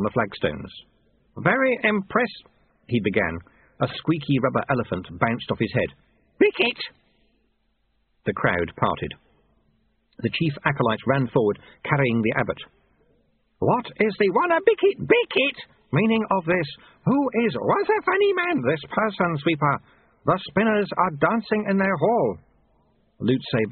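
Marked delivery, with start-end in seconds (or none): 1.4-2.4 high, slow abbot; high-pitched, measured character voice; abbot's voice. A narrator's reading. 6.4-6.8 put-on very high childlike cry; sudden high infant outburst; babyish demand. A narrator's reading. Babyish abbot calls for biscuit. 13.6-22.4 put-on high-pitched abbot with toddler outbursts; high, childlike character voice; babyish abbot. A narrator's reading.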